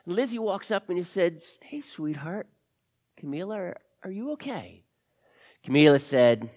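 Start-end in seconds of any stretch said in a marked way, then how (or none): 1.6-4.8 soft, tender check-in; gentle concern. Acted. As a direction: approach a crying child carefully. Lizzie approaches a crying girl.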